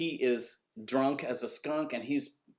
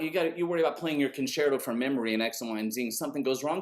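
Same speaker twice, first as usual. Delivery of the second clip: usual speaking voice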